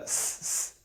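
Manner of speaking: 'س، س،' as a long hissing whistle, no voice; whistled s sound. Shown as a demonstration. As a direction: let the س whistle out through the teeth. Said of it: "وسل والی ہونی چاہیے".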